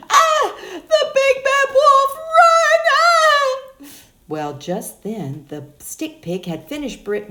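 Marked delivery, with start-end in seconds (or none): 0.1-3.6 shrill-high, loud squealing panic; panicked piglet. Acted. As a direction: scream and run from the wolf. Pig flees the wolf.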